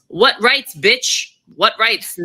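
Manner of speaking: ordinary talk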